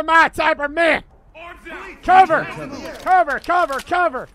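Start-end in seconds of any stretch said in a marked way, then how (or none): 2.0-4.3 urgent repeated call; shouted command. Pretend. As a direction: call cover loudly, fading. Calling cover to team.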